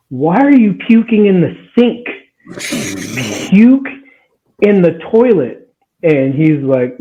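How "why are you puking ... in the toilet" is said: angry scolding yell. Retold or imitated. Mad sister to drunk boyfriend from bathroom doorway, imitated angry yell. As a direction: imitate someone hollering a furious order.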